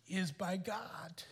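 soft unvoiced whisper to fathers; whispered appeal. Real, not acted. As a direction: lean in and whisper it. Pastor urges telling kids.

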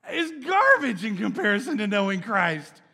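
Paul bursting with joy; a joyous shout of testimony; fervent Paul. Retold or imitated. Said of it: "Paul's testimony was".

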